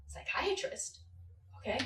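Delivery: whispered, high questioning echo; hushed disbelief. Acted. Sixteen-year-old hearing of a psychiatrist, incredulous. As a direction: repeat it barely voiced, rising in disbelief. Shocked echo of the ER's advice.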